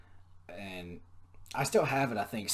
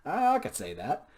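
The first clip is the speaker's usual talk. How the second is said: high, loud mock eye-roll; mocking the request, louder and higher; sarcastic; 9 semitones higher, 4 dB louder than usual. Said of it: "I was like".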